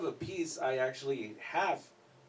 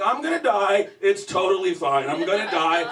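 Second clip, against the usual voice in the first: loud, higher freak-out; stoned panic attack; panicked; 4 semitones higher, 9 dB louder than usual. Retold or imitated. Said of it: "And I'm like".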